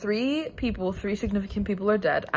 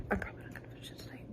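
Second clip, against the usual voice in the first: fast, whispered admission; whispered aside; 15 dB quieter, much faster than usual. Real, not acted. Admitting to viewers she'll stay up, fast whisper. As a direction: rush it out as a secret.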